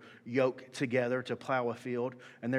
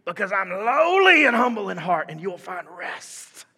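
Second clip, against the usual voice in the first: preaching, fading down; 6 semitones higher, 10 dB louder, slower than usual. Acted. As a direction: build it up then bring it down to a hush. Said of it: "I'm gonna read it like I believe it".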